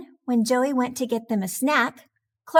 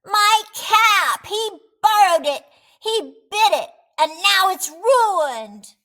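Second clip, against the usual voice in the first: loud, high tattling rant; a kid wailing about his ruined cap; outraged complaint; 8 semitones higher, 7 dB louder than usual. A narrator's reading. Clark complains to his mother about his brother, a loud high outraged rant. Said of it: "cried Clark".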